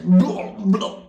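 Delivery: loud imitated underwater sputter; mock gasping for air. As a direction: splutter as if running out of air. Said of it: "I was running out of air".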